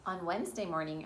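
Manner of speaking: usual speaking voice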